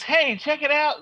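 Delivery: high, teasing "hey, check it out"; playful prankster. Retold or imitated. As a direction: lure him in with a grin. Comic strip, Green Lantern pranking.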